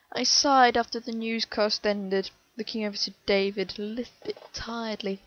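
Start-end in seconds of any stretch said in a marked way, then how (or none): none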